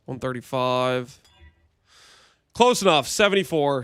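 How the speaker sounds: ordinary talk, nothing marked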